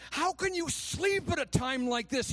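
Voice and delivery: shouting. A narrator's reading. Captain rousing sleeping Jonah, shouted. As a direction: shout it as the alarmed captain in a read story. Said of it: "he shouted".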